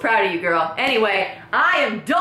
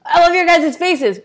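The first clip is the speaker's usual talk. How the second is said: louder, higher gushing; loud affection; 6 semitones higher, 7 dB louder than usual. Acted. Gushing at viewers, louder and higher. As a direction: shout the love. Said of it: "sign-off".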